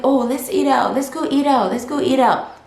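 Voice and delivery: high, insistent mock pleading to go; acted eager invite. Immigrant proposing to friends to eat out, high eager voice. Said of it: "So when you're like".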